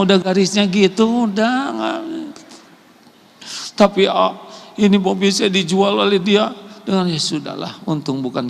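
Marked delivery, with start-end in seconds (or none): none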